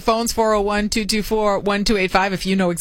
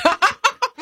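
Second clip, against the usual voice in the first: bright high giggle; high laugh. Real, not acted. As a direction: giggle in delight. Laughing at drink order.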